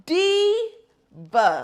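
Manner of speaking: high, loud, stretched-out word; big admiring holler, drawn out; drawn-out exclamation. Acted. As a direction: belt it slowly, syllable by syllable.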